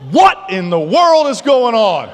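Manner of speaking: loud, slow, disbelieving cry with a laugh; stunned outburst. Retold or imitated. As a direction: shout it at the sky, half laughing. Outraged at being cut again.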